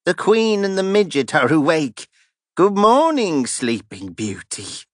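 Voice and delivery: high, sneering thief taunting his captives; mocking taunt. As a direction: leer and jeer at the captives in a high voice.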